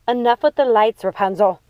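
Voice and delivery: angry mother scolding; yelling. Acted. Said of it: "yelled Gothel".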